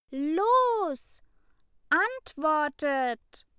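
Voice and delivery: high, loud, slow furious command; angry hiss. A narrator's reading. Ida demands answers from her classmates, furious. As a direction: spit out the order, enraged. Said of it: "zischte Ida".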